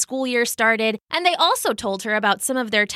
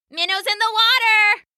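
high, louder sing-out; shouted game call; 12 semitones higher, 5 dB louder than usual. A narrator's reading. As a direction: shout it out as the shark in a pool game. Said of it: "shouted Katie, the shark".

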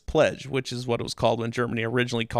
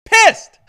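pissed hit much higher and louder; big emphasis; 12 dB louder than usual. Real, not acted. Telling co-host how furious Americans were, with pissed stressed high and loud.

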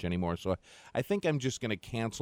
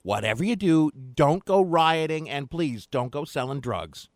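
hammed-up grandma voice, loud and drawn out; 7 semitones higher, 5 dB louder than usual.

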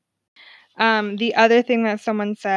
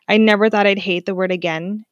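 usual speaking voice

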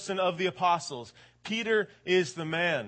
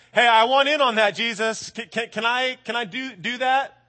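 louder, higher eager shout; eager call; 5 semitones higher, 7 dB louder than usual. Retold or imitated. Peter asking Jesus to walk on water, eager. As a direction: call out, keen to join in.

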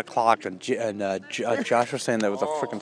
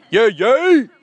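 high loud yay; excited cheer; 12 semitones higher, 9 dB louder than usual. Real, not acted. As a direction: cheer with glee. Cheering at flag lowering.

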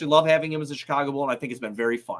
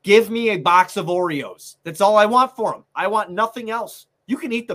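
angry outburst; 5 semitones higher, 6 dB louder than usual. Real, not acted. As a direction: shout it out in fed-up frustration. Angry fan rant.

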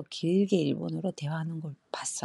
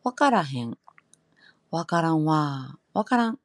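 louder Kansai 와카라헨; Kansai dialect; 4 dB louder than usual. Shown as a demonstration. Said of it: "칸사이 쪽은".

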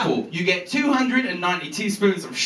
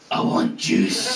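slow, low croak with almost no voice; imitating a wrecked morning-after groan; hungover Glaswegian girlfriend; 11 semitones lower, much slower than usual. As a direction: rasp it out like a hungover Scot. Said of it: "She was hungover, obviously".

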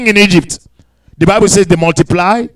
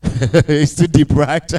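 laughing; 6 semitones lower, 6 dB quieter, much slower than usual. Real, not acted. Preacher chuckles at the depth.

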